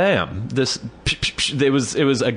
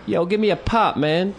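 high, cocky voice of a street guy begging; pitched-up mock street guy asking for a soda; street hustler; 10 semitones higher than usual. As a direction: put on the smoker outside the shop. Street guy begging outside shop.

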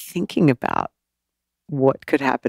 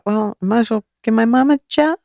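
breezy decision; 9 semitones higher than usual. Retold or imitated. Acting out her past thought.

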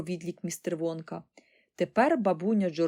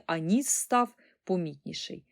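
ordinary delivery, nothing marked

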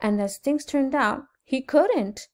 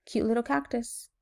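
usual speaking voice

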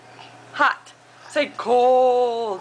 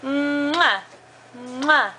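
exaggerated smacking "mwah"; playful kiss noise; blown kiss; slower than usual. Can you blow mommy a kiss.

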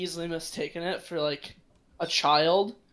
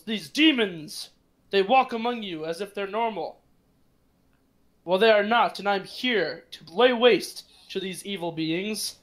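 zealous preacher; 4 semitones higher than usual. Acted. To the townsfolk, a zealot denouncing the party, high and ringing. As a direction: proclaim it to the whole square. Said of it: "and he says".

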